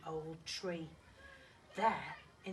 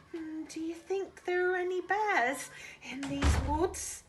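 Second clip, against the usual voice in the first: nervous whisper; 9 semitones higher, 6 dB louder than usual. Acted. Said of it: "he whispered".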